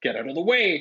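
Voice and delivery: loud angry snap at the poltergeist; loud snapped command; snapping. A narrator's reading. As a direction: bark it at him in irritation. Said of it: "snapped Ron".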